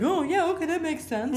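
mock naive. Film students accepting bad advice, high and mock naive. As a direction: play the gullible student.

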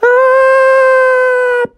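high cartoon scream; falling scream. Froggy dropped from the sky, long high scream. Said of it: "'cause the whirlwind dropped him".

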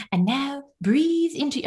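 imitating a London monk leading meditation, high and slow; drawn-out London-accented guru; thick London accent. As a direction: put on a thick London accent, stretched out slowly. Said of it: "this thick London accent".

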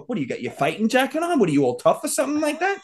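high, quick sarcastic jeer; retold goading question; mocking taunt. Retold taunting before a fight.

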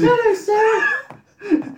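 very high, slow falsetto hello; high-pitched mum voice. Retold or imitated. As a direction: squeak it out like a mother on the phone. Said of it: "Speaking like the mum".

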